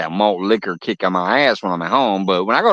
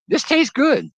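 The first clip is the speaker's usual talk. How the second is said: pleasantly surprised. Retold or imitated. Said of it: "I'm like".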